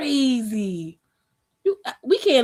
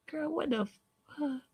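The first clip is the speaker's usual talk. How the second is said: stunned high disbelief; 6 semitones higher, 13 dB quieter than usual. Real, not acted. Disbelief at a comment.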